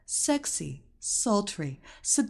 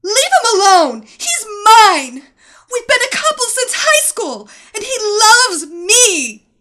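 screaming, near tears; 13 semitones higher, 16 dB louder than usual. Acted. Wife screams at rival.

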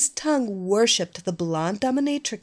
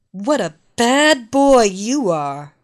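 loud, commanding mistress voice; scolding dominatrix; 3 semitones higher, 9 dB louder than usual. Acted. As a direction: scold him from above. Evelina scolds her captive.

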